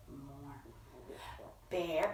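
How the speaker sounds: ordinary delivery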